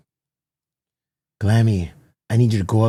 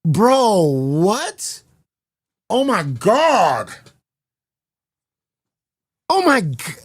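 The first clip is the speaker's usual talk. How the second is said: much higher, stunned squeals of disbelief; shocked disbelief; 11 semitones higher, 3 dB louder, slower than usual. Real, not acted. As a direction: react like you can't believe the number. Shocked by twelve-second count.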